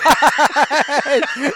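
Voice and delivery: high, loud burst of laughter. Real, not acted. Host cracking up at the Undertaker joke, high laugh.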